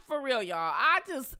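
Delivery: ordinary delivery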